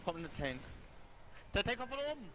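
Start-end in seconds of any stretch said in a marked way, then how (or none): 1.5-2.3 high, loud alarmed call; excited warning call. Real, not acted.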